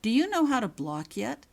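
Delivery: ordinary delivery, nothing marked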